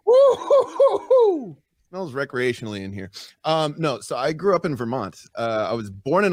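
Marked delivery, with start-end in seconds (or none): 0.0-1.6 high, drawn-out laughing hoot; giggly whoop. Real, not acted. Guest cracking up at banter, high giggly whoop. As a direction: let out a long falsetto woo-hoo.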